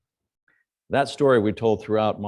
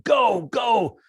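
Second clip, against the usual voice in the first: high excited yell, much louder; retold yell; 13 semitones higher, 7 dB louder than usual. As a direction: shout go go to urge the Jeep on. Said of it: "is yelling".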